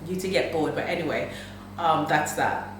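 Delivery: usual speaking voice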